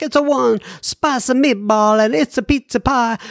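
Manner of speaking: high-pitched stock Italian accent; demonstrated fake Italian accent; mock Italian accent. Shown as a demonstration. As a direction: imitate an Italian, cartoon style. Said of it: "when we imitate people that are from".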